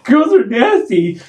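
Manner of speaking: put-on high, slow childish protest; kid voice. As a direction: protest like a little boy. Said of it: "I was like".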